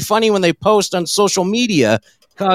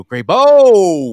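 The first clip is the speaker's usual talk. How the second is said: calling out; 5 dB louder than usual. Retold or imitated. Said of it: "we're just gonna go".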